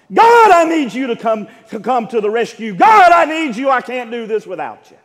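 high, loud cries of Lord, have mercy, slowing on help me; desperate pleading. Acted. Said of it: "She was pleading and pleading. She was saying".

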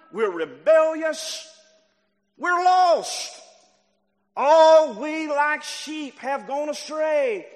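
loud, high pulpit cry; full-force preaching. Real, not acted. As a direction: hammer each short phrase at the congregation.

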